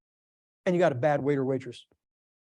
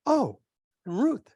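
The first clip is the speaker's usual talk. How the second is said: high startled "Oh, Ruth"; mildly startled at the plate; startled mother-in-law at the trout; startled gasp; 6 semitones higher than usual. Retold or imitated. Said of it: "she went, she went".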